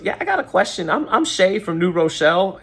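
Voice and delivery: Shay from New Rochelle. Retold or imitated. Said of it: "she goes".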